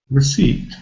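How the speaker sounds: Receipt with a reduced first syllable; weak first syllable. Shown as a demonstration. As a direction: say Receipt with a light re-. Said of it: "the first syllable is weak".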